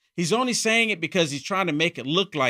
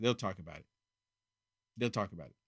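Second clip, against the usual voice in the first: much softer and lower, repeated to himself; quiet echo; 11 semitones lower, 13 dB quieter, faster than usual. Real, not acted. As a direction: let it fall away to a murmur. Echoing himself, dropping away.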